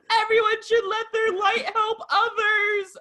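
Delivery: very high squealed echo of the line; mock overcome gushing. Fan repeating [name]'s message back, high gushing squeal. Gushing imitation of own reaction.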